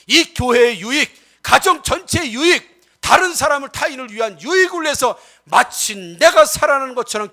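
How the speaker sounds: forceful sermon with punched key words; emphatic pulpit exhortation; impassioned preaching. Real, not acted. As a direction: preach it out with drive, stretching key phrases. Preaches to the congregation.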